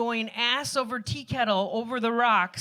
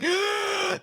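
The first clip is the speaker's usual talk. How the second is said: sharp high gasp of discovery; 9 semitones higher than usual. Retold or imitated. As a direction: gasp as if you just spotted it. Spots the sunken canoe.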